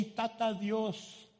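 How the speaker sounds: Tata Dios said slowly in Spanish. Shown as a demonstration. Preacher giving the Spanish for Daddy God, slowly. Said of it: "In Spanish, a lot of people say".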